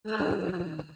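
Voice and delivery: soft, breathy long exhale; sighing out the breath; deep-breath sigh. Shown as a demonstration. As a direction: release a deep breath audibly. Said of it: "Deep breath".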